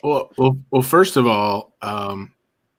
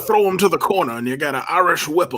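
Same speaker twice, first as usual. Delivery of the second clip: put-on southern old-timer; 7 semitones higher, faster than usual. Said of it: "How did Nick Bockwinkel sound".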